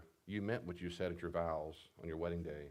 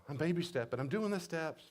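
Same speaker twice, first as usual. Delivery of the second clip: high, excited movie-character voice; giddy movie quote; What About Bob quote; 9 semitones higher, 4 dB louder than usual. Retold or imitated. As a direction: squeal it like a proud patient. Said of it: "You ever seen What About Bob?".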